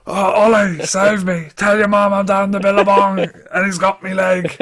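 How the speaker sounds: put-on Australian accent. Podcaster playing a Bunyip victim pleading in a mock Australian accent. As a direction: call out for help in a bad Australian accent. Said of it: "the Australian accent is quite hard to do".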